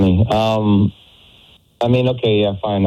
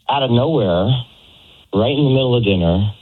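ordinary talk

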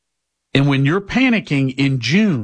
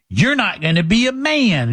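high mock-nagging parent voice; hammed-up parental scolding; 8 semitones higher than usual. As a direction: warn a small child in a pitched-up singsong. Parent scolding six-year-old over dinner.

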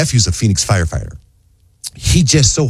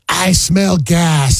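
drawn-out, higher-pitched announcement; mock alarm; 8 semitones higher, much slower than usual. Wife raising the gas alarm as they walk in, drawn-out mock alarm. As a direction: imitate an alarmed call, stretching each word. Imitating wife's alarm on entering.

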